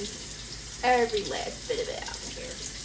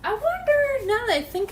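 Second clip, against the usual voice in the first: sudden realization; 12 semitones higher, 6 dB louder than usual. Real, not acted. Sudden realization, higher louder.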